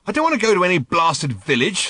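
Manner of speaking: sharp irritated snap; annoyed snap at Arthur's plan; snapped. A narrator's reading. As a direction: bite the words off at Arthur. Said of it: "snapped Ford".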